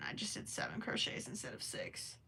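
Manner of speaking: low breathy whisper while counting stitches. Real, not acted. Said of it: "Sorry, I'm counting".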